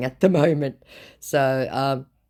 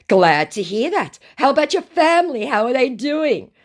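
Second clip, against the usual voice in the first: high, loud mock interest; scammer's put-on voice; 13 semitones higher, 6 dB louder than usual. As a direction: feign eager interest as the scammer.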